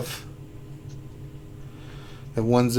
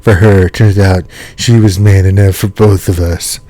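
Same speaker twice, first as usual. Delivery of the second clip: much louder put-on storytelling voice; 3 semitones lower, 13 dB louder than usual. Streamer reads a viewer's story aloud, in her imitated voice. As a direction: read it out loud in someone else's voice. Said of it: "I'll do it in Ur's voice".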